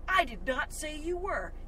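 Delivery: a lightly voiced big brother, calm and slow; older brother voice. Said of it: "in a voice of my older brother".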